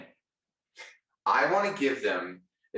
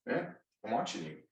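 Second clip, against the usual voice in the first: a soft, teasing "I'm watching you"; mock warning; 8 dB quieter than usual. Speaker jokingly warning salespeople, soft and teasing. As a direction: hush it like a playful threat.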